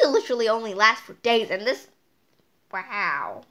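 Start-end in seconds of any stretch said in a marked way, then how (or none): none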